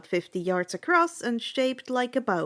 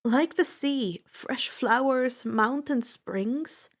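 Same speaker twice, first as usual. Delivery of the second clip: ordinary delivery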